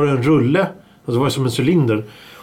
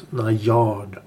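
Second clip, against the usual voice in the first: Najard, lower and softer, weight on the last A; stress on second A; 6 semitones lower, 5 dB quieter than usual. Shown as a demonstration. Host demonstrating to co-hosts, Najard stressed on final A. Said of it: "Betoning på A".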